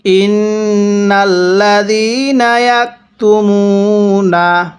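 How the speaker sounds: slow, drawn-out tajweed chanting; slow Quran recitation. Shown as a demonstration. Teacher demonstrating recitation to students, slow chant. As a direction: recite each syllable long and measured. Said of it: "তাহলে".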